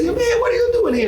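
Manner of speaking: delighted high yell of recognition; Sal's greeting voice. Retold or imitated. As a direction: call out like an old friend surprised to see you.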